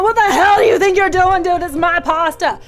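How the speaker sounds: high, loud outraged outburst; infuriated yelling. Retold or imitated. Teller catching the mouse taking pasta back, infuriated yell. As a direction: explode in hammed-up fury. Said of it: "that totally infuriated me".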